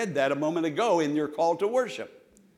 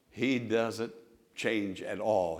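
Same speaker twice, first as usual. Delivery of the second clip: ordinary talk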